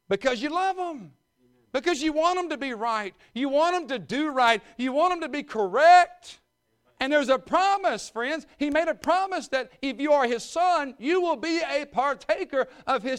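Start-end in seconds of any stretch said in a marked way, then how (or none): 0.1-8.9 loud, high preaching rising in force; preaching at full force. Real, not acted. Preacher urging the congregation, in full force.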